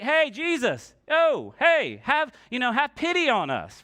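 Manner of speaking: calling out loudly. Acted. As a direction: hail someone from a distance, pleading for pity. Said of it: "out in a loud voice".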